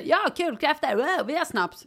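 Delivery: high, eager mock group chatter; imitated enthusiasm. Friends agree on an impromptu crayfish party, mock-eager. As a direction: imitate friends getting keen on a plan.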